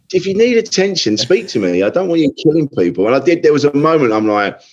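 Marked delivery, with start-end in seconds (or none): 0.1-3.1 lightly stern parent. Retold or imitated. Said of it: "And I'm like".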